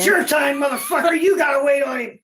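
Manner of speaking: hammed-up shouting clerk; mock clerk yell. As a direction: yell it like a fed-up store clerk. Mock paint store clerk yelling.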